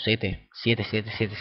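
ordinary delivery

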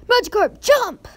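high, loud shouted order; punchy pretend battle call; battle command. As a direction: urge your creature on like a trainer. Calling command to his Magikarp.

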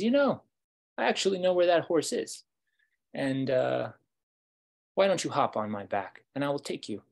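high, loud, brimming with news; eager helpful wolf. Acted. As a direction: pitch leaps up, then settles into an offer. Wolf eagerly offers to help.

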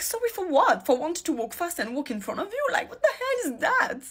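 a retold indignant snap, high and half laughing; indignant retort. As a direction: replay the comeback with incredulous, laughing disbelief. Retold street argument, indignant reply.

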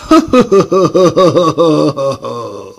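loud evil cackle; evil laugh. Acted. Plotter cackles about becoming Mikulás, loud. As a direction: gloat with a big cackle.

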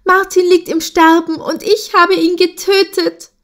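high, anguished outburst; high panicked confession; distraught cry. A narrator's reading. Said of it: "rief sie".

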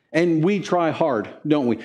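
ordinary delivery

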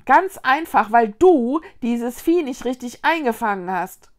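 heated finger-pointing outburst; reproachful. A narrator's reading. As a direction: throw the blame at her. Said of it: "rief Konrad vorwurfsvoll".

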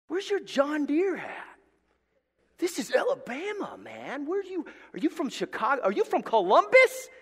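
high, mocking rant rising to loud; mocking jab. Preacher mocking a punk customer, high and jeering. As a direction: needle the spiky-haired guy. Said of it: "No, I'm just kidding".

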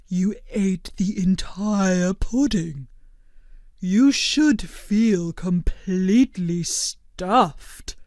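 slow, amazed blob; gently astonished; Blobbert's voice. A narrator's reading. Blobbert amazed at Turkey eating the whole pudding, slow.

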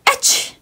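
breathy sneeze. Acted. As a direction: let out a sneeze. Acting out a sneeze.

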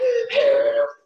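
loud, high gasp of shock; imitated gasp. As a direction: gasp like the panicked friend. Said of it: "She's like".

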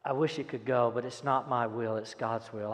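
usual speaking voice

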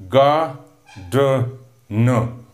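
"Ga, d, n" as three separate beats; split into syllables. Shown as a demonstration. Teacher sounding out gardener for students, "Ga, d, n" one by one. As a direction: sound out "Ga, d, n" with a pause after each. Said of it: "Three syllables".

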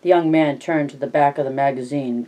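plain reading voice

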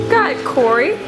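ordinary delivery

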